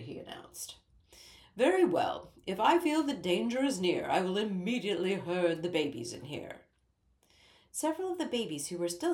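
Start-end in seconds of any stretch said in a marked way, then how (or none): 1.6-6.7 a lightly voiced king, louder and resolute; decisive king. A narrator's reading. King tells Ellie he will herd the babies inside, firm. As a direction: announce the plan with royal firmness.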